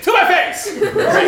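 high, loud challenge; defiant shout. Retold or imitated. Blunt professor answering the preacher, defiant shout. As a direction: fire it straight back.

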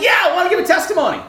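high, loud, fast eagerness; excited yes. Retold or imitated. Professor asking to give testimony, excited. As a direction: jump on the word, thrilled.

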